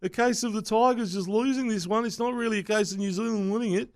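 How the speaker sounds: high, smug commentator voice; snooty commentator impression; mocking Blocker Roach. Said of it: "he's always like".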